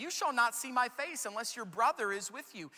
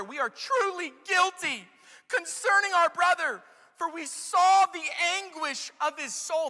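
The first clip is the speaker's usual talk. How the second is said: loud, high anguished confession; guilty confession; 7 semitones higher, 7 dB louder than usual. Acted. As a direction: cry out their guilt. Brothers' guilty confession in scripture reading.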